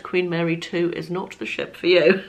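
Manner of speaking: ordinary delivery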